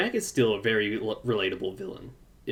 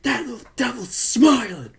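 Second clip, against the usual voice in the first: high, loud indignant squeal; mock outrage; 12 semitones higher, 7 dB louder than usual. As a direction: re-enact scandalized fury. Acting outrage at smirking villain.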